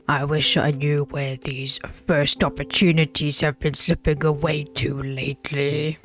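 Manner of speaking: slow, put-on sassy voice; gruff, sassy retort; sassy wife. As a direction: play the wife with drawling sass. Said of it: "she's sassy with it too".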